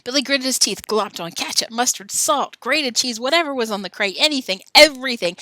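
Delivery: building excitement. Shown as a demonstration.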